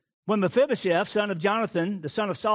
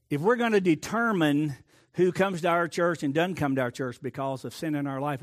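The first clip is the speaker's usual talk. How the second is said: ordinary talk, nothing marked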